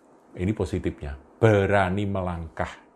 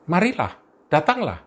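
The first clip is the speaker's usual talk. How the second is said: inviting call; 10 semitones higher, 4 dB louder than usual. Acted. Jesus answering Peter.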